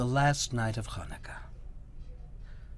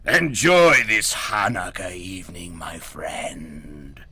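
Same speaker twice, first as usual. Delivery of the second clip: goblin king; 8 dB louder, slower than usual. Acted. Goblin king whispering threats to Herschel, menacing. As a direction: whisper a cold threat from far away.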